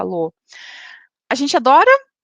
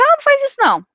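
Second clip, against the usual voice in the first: high, louder irritated snap at a spouse; 13 semitones higher, 4 dB louder, faster than usual. Retold or imitated. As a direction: snap back at your partner, mock annoyed. Spouse snapping in example.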